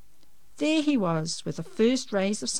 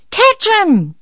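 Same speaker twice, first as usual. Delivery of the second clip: high, loud urgent order; yelling; 15 dB louder than usual. A narrator's reading. Mum as the rooster flaps round the room, yelling. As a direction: yell it frantically across the room. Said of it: "yelled Mum".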